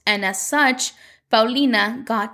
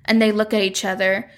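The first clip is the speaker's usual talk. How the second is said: normal talking voice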